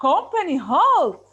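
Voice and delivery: loud, slow army order; loud command. Shown as a demonstration. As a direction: bark the halt to the troops. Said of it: "you have to say it in a loud voice".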